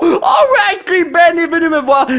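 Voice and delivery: high, loud cheer; excited cheer. Real, not acted. To his son over the pull, excited cheer.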